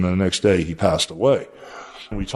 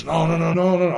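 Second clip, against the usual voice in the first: retold panicky no's, pitched much higher; imitating a startled man waving him off; alarmed refusal; 9 semitones higher than usual. Warrior startled by an approaching old rival, imitated panicky no's.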